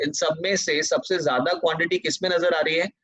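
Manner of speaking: usual speaking voice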